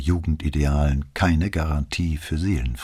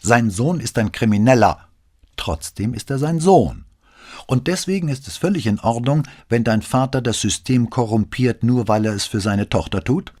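higher and louder, furious accusation; hot anger; 7 semitones higher, 4 dB louder than usual. Acted. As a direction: let the anger boil over at her. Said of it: "von heißem Zorn gepackt".

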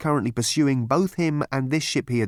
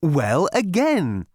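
chirpy psychiatrist; 4 semitones higher, 4 dB louder, much slower than usual. A narrator's reading. Psychiatrist speaking.

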